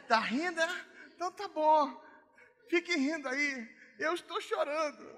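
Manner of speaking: high, loud mock-crying child; sulking daughter. Daughter sulking at God while crying, high whiny complaint. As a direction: whine it like a wounded little girl.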